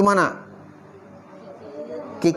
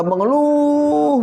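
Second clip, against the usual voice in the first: "mengeluh" stretched long on a raised note; drawn-out complaint; 5 semitones higher, much slower than usual. Shown as a demonstration. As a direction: draw out the last syllable of "mengeluh" like moaning. Illustrating constant complaining.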